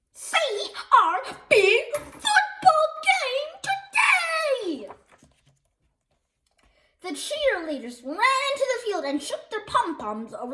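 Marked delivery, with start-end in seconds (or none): 0.1-4.9 high, slow sing-song cheer; cheery announcer. A narrator's reading. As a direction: call it out like a pep rally banner. Cheery banner read out.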